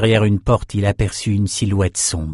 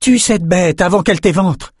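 high-pitched, raised-voice command; called-out urging; 9 semitones higher, 4 dB louder than usual. A narrator's reading. Lila warning the swordsman to kill first, calling out. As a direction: urge the fighter to strike. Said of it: "lança Lila".

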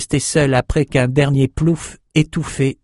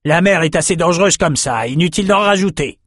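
roaring; 7 semitones higher than usual. Acted. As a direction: bellow it over the crew. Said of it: "rugit le capitaine Eli".

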